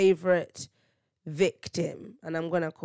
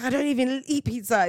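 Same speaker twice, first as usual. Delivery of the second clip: higher, louder mock outrage; retold indignation; 7 semitones higher, 5 dB louder than usual. Imitating dad protesting the prank pizza, mock mad. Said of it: "He was so not happy".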